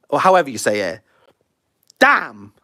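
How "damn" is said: ordinary delivery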